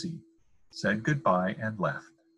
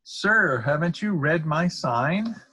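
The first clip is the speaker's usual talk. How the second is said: higher, louder, drawn-out indignant call; shouting; 6 semitones higher, 5 dB louder, slower than usual. A narrator's reading. Dentist challenging a fox at the door, shouting. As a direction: shout it slowly at the caller below. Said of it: "Doctor DeSoto shouted".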